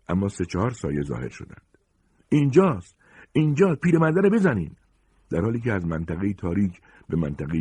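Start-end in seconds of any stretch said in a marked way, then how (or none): 2.3-4.8 high, loud yell to the gang; shouted alarm. Acted. Pursuers spotting the old man, high shouted command. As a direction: shout the order to attack.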